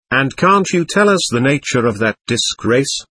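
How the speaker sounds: plain reading voice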